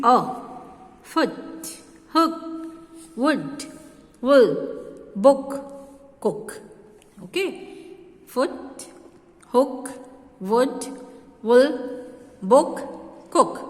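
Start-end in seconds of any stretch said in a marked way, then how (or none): 1.0-6.6 short oo in "Foot", "hook", "wood", said like "put"; short double O. Shown as a demonstration. Teacher modelling the short sound of "wool" and "cook". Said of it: "this double O sounds like". 8.3-13.6 short oo in "Foot", "hook", "wood", said like "put"; short double O. Shown as a demonstration. Teacher modelling the short sound of "wool" and "cook". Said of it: "this double O sounds like".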